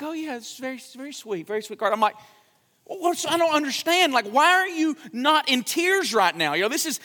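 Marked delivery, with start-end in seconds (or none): 0.0-1.9 lukewarm politeness. Retold or imitated. Wife's lukewarm reply, retold. 2.9-6.5 higher, puzzled self-quote; pitched-up baffled question to his wife; baffled husband. Retold or imitated. His own baffled question, retold.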